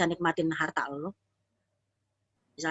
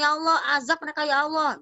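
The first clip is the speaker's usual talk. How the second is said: annoyed prophet's prayer; 7 semitones higher than usual. Acted. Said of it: "Nabi Musa kesel sama umatnya".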